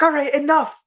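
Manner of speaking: high-pitched imitation of fed-up anger; mock exasperation. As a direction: cut them off like an outraged friend. Acts Kyle interrupting in a quarrel.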